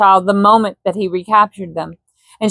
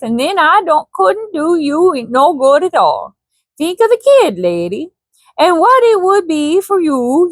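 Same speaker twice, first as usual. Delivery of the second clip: put-on Swedish accent; 5 semitones higher than usual. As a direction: press her in a Swedish accent. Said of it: "didn't do his accent".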